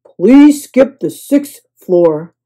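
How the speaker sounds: slow, loud plea; pleading. A narrator's reading. Guest to bellhop, slowly pleading. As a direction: beg him to skip it. Avoiding bees.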